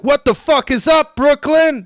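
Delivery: high, loud hype-man yell; comic yelling at the crowd to pump them up; mock hype shout. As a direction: blast it like an MC opening a show. Comic storms the open mic stage.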